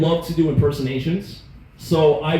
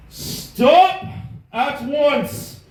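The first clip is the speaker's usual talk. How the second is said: high, raised order; mock command; 8 semitones higher, much slower than usual. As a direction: order them to stop. Commanding the fighters to stop.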